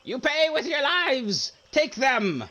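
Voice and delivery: high, loud goblin shriek; shrieking Yaka. Acted. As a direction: shriek a furious order at your minions. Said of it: "Yaka shrieks and he's like".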